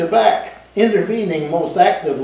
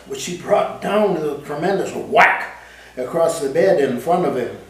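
plain reading voice

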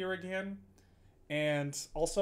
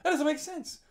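much higher, fast mock confusion; imitating confused self; 13 semitones higher, 5 dB louder, faster than usual. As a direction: blurt it out like you've lost the thread. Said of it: "I'm like".

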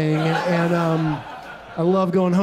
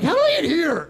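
high-pitched incredulous self-question; mock bewilderment; 9 semitones higher than usual. Comic to crowd, holding a drool-covered egg, mock bewilderment. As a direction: squeak it out in comic disbelief.

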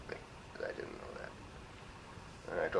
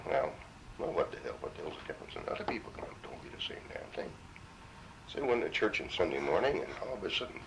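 ordinary delivery

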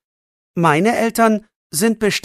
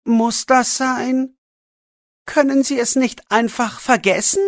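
pleading; 7 semitones higher than usual. A narrator's reading. Boy begging the man not to call parents, pleading. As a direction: plead with him to forget it. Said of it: "bittet Jannik".

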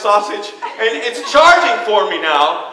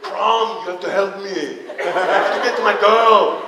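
lower, softer hint of a Conan-style barbarian; a hint of Schwarzenegger's barbarian praying; inner barbarian voice; 4 semitones lower, 3 dB quieter than usual. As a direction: plead to the war god like a lightly voiced Conan. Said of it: "in my inner self voice".

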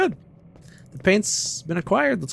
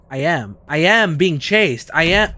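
usual speaking voice

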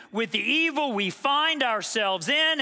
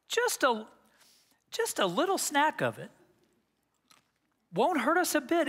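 normal talking voice

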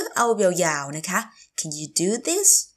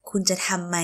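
ordinary talk, nothing marked

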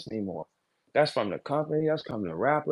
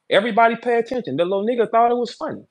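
higher, louder, quick announcement; calling out; 7 semitones higher, 4 dB louder, faster than usual. Real, not acted. Battler telling everybody his opponent found it funny, calling out. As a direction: call the whole room to attention, fast and high.

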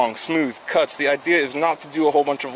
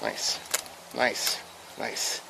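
soft, near-whispered repeats; murmured nice; 10 dB quieter, much slower than usual. Real, not acted. Cook murmuring to himself as the fillet lifts. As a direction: trail the praise off quietly.

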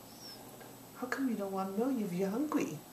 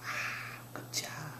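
whispered good food; whispered praise; 4 semitones lower, 8 dB quieter, much slower than usual. Real, not acted. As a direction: breathe the praise softly.

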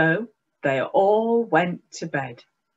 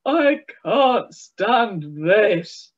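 slow, drawn-out groan of complaint; weary Mister Bear; 3 semitones higher, 5 dB louder, much slower than usual. A narrator's reading. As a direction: drag it out like a tired, fed-up bear.

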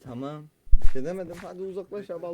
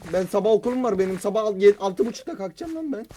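angry shouting; 2 semitones higher, 9 dB louder than usual. Real, not acted. Angry rant about waking early.

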